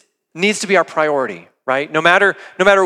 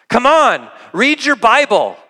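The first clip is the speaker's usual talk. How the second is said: higher-pitched coaxing plea; urgent exhortation; 6 semitones higher, 4 dB louder than usual. Retold or imitated. Paul exhorting the pastor to get into it, urging. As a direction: exhort them, pitch lifted on each phrase. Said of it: "Paul has to exhort you".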